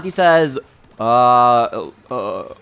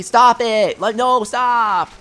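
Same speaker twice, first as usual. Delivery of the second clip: mock protest; 8 semitones higher, much slower than usual. Reacting to chat, mock protest.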